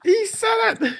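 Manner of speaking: high squealing shout; shrieking. Real, not acted. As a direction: screech it in gleeful disbelief. Repeating the mock.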